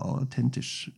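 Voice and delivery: whispering. Acted. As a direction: whisper it like a secret aside. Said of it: "sag das ganz leise".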